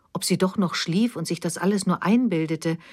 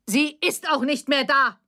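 high-pitched, heated retort; yelling back; 7 semitones higher than usual. A narrator's reading. Mother answers her daughter's outburst, yelling back. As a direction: throw it back at a raised pitch. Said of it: "schrie Evelyn nun zurück".

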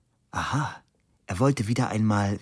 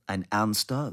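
ordinary delivery, nothing marked

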